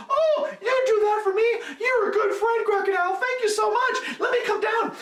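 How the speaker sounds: very high and loud, laughing with joy; thrilled monkey. Acted. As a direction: gush with delight like a grateful little monkey.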